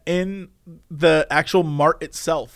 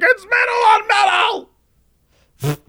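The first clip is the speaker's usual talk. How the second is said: much higher shouted panic; hammed-up frantic insisting; mock high panic; panicked squeal; 14 semitones higher, faster than usual. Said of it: "He's like".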